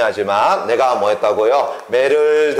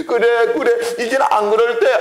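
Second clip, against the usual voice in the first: mock boy's promise; 7 semitones higher than usual. Boy promising Jeomsun.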